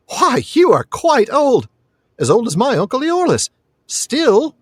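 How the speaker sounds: put-on high, slow boyish wonder; high-pitched child character; amazed young lad. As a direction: marvel like an astonished boy.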